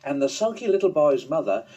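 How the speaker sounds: ordinary delivery, nothing marked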